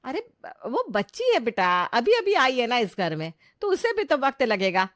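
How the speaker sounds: high, loud, placating protest; protesting mother. Acted. Mother defends new bride to angry son, protesting. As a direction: jump in pleading the bride's case.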